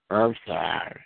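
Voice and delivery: put-on low, grudging child's sorry; insincere apology. Child made to apologize to a sibling, insincerely. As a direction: mumble a reluctant, unmeant apology. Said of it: "you don't say that right".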